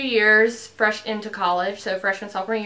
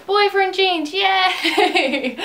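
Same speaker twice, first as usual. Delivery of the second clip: high, loud happy cheer with a laugh; giddy burst of joy; excited cheer; 10 semitones higher, 5 dB louder, much slower than usual. Real, not acted. Showing off her jeans on camera.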